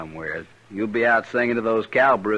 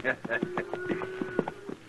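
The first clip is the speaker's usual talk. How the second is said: hearty laugh; 11 dB quieter than usual. Acted. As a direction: laugh hard at the jibe. Trail boss amused by the teasing.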